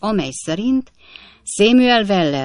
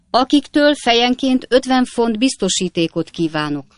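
higher, pompous official pronouncement; loud and dignified; 6 semitones higher, 3 dB louder than usual. A narrator's reading. The mayor grandly announcing bail to the court. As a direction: proclaim it with great self-importance. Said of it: "mondta az elöljáró fennhangon".

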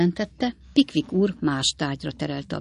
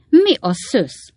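loud, high, drawn-out cry; astonished exclamation; 7 semitones higher, 7 dB louder, much slower than usual. A narrator's reading. Three friends cry out at Sam's news, exclaiming loudly. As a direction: exclaim it slowly, pitched up. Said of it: "kiáltott fel a három jó barát".

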